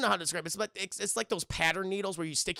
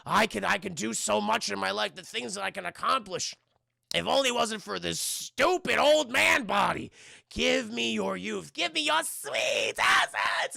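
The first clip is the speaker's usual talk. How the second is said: hammed-up raging demand, high and straining; mad, aggressive; 5 semitones higher, 4 dB louder than usual. Sick old man demands the hero's youth, hammed-up aggressive. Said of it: "he gets mad. He gets aggressive".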